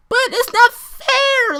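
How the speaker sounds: very high, loud, drawn-out mock whine; whining girls. Imitating girls objecting at the lecture, shrill mock whine. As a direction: imitate girls protesting in falsetto.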